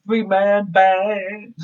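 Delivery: slow, drawn-out mock Southern twang on "Three-man band"; Heath Slater accent. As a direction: stretch it out in Slater's Southern accent, half joking. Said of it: "Does he do that accent?".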